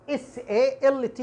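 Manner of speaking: S A L T spelled letter by letter, very slow; spelled out slowly. Shown as a demonstration. Teacher spelling out the word for the class. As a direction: spell S A L T deliberately. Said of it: "مثل كلمة الملح".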